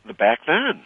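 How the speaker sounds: quick hillbilly twang; hillbilly accent. Pretend. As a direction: toss it off in a hillbilly voice. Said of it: "now he went his hillbilly voice again".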